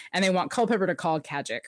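normal talking voice

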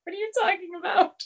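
high, fast baffled question; confused Nate. Retold or imitated. Host voicing Nate cuffed to a chair, high and baffled. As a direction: sound totally thrown.

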